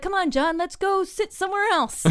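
high-pitched imitated cajoling; mock coaxing. Band pulling trashed drummer off stage, mock coaxing. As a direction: coax a drunk friend away.

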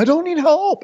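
very high-pitched protest; imitating a stubborn man whining; mock defiant outburst. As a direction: squeal it in hammed-up defiance.

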